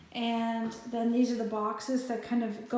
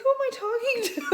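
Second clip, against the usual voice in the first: much higher, laughing and baffled; laughing disbelief; 12 semitones higher, 5 dB louder, much faster than usual. Retold or imitated. Retelling the confusing phone call, in high laughing disbelief. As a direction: squeak it out through laughter. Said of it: "I'm like".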